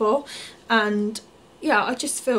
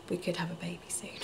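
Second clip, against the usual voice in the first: hushed whisper; 4 semitones lower, 14 dB quieter, faster than usual. Real, not acted. Teasing hint of labour soon.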